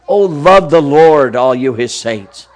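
drawn-out earnest call; repeated psalm plea; slow fervent repeat. Acted. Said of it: "psalm repeated".

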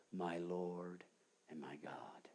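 low, fading to a whisper; awed worship. Acted. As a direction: breathe it in reverent awe. Thomas falls down worshipping.

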